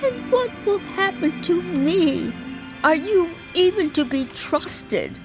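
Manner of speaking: high, frightened questioning; fearful high cry. Acted. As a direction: cry out in fear and doubt. Joan questions the angel in fear.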